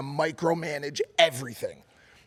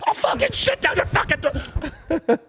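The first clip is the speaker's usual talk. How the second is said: much higher mock screaming threat; imitating a furious threat; 12 semitones higher than usual. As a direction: spit it out like a raging drunk. Dad threatening in retold brawl.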